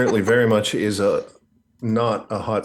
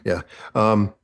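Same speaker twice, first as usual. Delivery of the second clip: usual speaking voice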